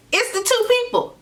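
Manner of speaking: high-pitched, drop the gossip bombshell; scandalized reveal. Retold or imitated. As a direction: squeal out the big reveal. Reveals who was on stairs.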